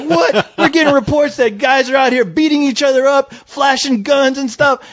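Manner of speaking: high-pitched, incredulous cop voice; confrontational cop. Retold or imitated. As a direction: play an accusing officer, pitch up. Retold cop confronting the filmmakers.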